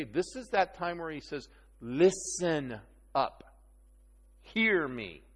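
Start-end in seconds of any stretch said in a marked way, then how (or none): 1.8-5.2 slow, drawn-out LISTEN up, then a firm HEAR me; emphatic. Acted. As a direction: demand attention, stretching "Listen". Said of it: "This is an emphatic".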